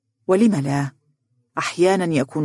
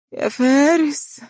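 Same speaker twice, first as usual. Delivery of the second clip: higher, slow, drawn-out second call; stronger call; 10 semitones higher, slower than usual. A narrator's reading. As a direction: call out again, reaching higher. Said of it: "تناديه بوهن".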